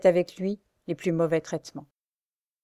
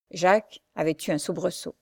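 ordinary delivery, nothing marked

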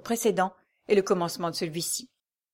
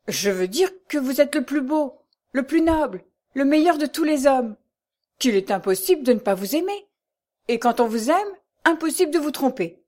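higher, impassioned protest; fervent admiration; 6 semitones higher than usual. A narrator's reading. Valet praising his master as best of men, fervently. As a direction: insist with devoted ardour.

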